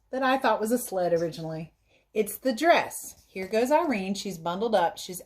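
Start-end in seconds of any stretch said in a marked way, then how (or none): none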